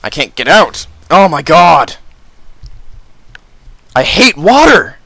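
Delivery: high, loud panicked cries; panic, trapped and yelling; terrified horror victim. Real, not acted. Trapped in water.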